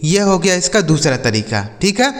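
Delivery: ordinary talk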